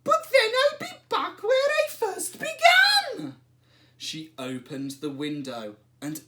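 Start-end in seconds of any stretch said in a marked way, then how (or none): 0.0-3.4 put-on very high, loud protesting old lady. A narrator's reading. Old lady objects to the wise man, high and loud. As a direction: squeak it out like a startled old lady.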